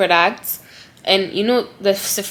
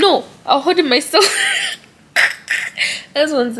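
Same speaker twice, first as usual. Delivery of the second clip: laughing through tears; 7 semitones higher than usual. Real, not acted. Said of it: "You're going to make me cry".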